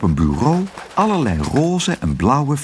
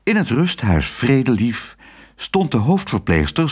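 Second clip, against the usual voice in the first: ordinary delivery, nothing marked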